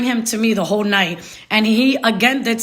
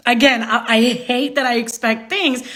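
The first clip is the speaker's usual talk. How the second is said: ordinary talk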